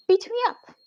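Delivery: very high-pitched mock taunt; imitating boss's dare. Boss daring the angry intern, high mimicked taunt. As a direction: squeal the dare in a put-on high voice. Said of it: "looks at me and he tells me".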